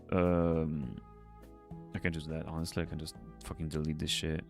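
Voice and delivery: normal talking voice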